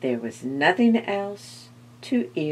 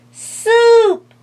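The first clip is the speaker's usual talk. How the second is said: high, loud delighted shout; excited cry; 13 dB louder than usual. A narrator's reading. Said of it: "said the skeleton to itself".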